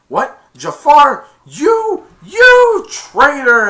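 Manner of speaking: high, loud, slow outraged yell; hammed-up royal fury, drawn out. The Sultan shouting at Jafar, loud and high.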